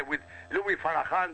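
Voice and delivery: usual speaking voice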